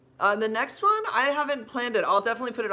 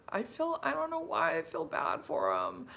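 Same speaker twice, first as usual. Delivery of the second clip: daughter's voice, much higher pitched; higher kid voice: I feel bad for him; imitating Ryan; 12 semitones higher than usual. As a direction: quote her child in a lifted voice. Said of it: "Ryan kept saying".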